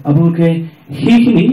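usual speaking voice

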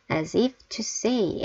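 very slow, each word drawn out; slow teacher demo; slow model reading. Shown as a demonstration. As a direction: spell it out slowly for learners. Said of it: "teacher models phrase slowly".

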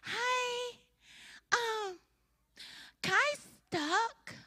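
put-on high, soft, worried little girl; timid neighbor kid reporting trouble. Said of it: "She said".

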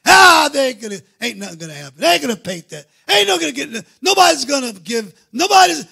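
high, loud scoffing gripe; griping complainer. Retold or imitated. Doubters griping that the church project will fail, in a loud scoffing gripe. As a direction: grumble it out like a naysayer. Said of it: "groping and griping, complaining".

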